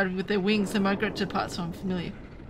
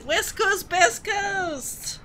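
higher, cheering shout; excited cheer; 9 semitones higher, much slower than usual. Real, not acted. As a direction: shout it out like a proud local. Cheering for home region.

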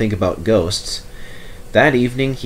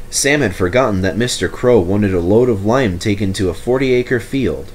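plain reading voice

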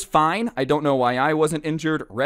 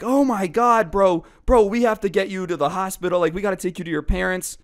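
higher, urgent alarm, retold; 5 semitones higher than usual. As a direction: blurt it out in mock panic. Friends react to the bloody injury.

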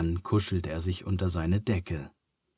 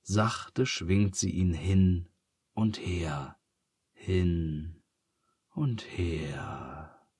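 lulling; 2 semitones lower, 3 dB quieter, much slower than usual. A narrator's reading. Lulling narration of swaying.

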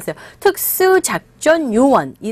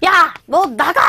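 furious sister shouting; angry yell; 6 semitones higher, slower than usual. Retold or imitated. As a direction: tell him to get out in a temper. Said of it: "성질이 나서".

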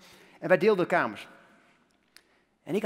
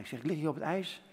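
ordinary talk, nothing marked

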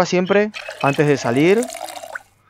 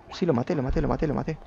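much softer, under the breath; hushed self-reassurance; 3 semitones lower, 10 dB quieter, faster than usual. Real, not acted. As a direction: repeat it quietly to yourself. Se asegura tras matar al zombi. (en voz baja, para sí mismo)

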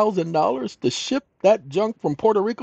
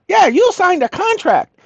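high, smug officer voice; mock probation officer; 13 semitones higher, 3 dB louder than usual. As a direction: play her as pleased with herself, pitched up.

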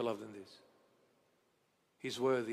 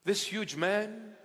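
ordinary talk, nothing marked

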